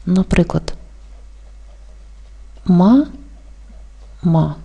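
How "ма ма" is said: syllable by syllable. Shown as a demonstration. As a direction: split into two open syllables, "ма" pause "ма". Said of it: "Відкритий склад — це склад". (по складах: «ма», пауза, «ма»)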